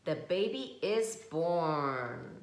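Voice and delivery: ordinary delivery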